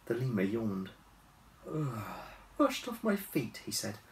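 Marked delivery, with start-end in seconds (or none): 1.7-3.6 weary. Acted.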